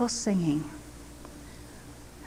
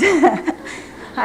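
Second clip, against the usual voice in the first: real laugh; 5 semitones higher, 3 dB louder than usual. Real, not acted. Laughs at wanting to eat them all.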